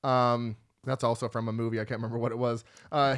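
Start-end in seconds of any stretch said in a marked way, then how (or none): none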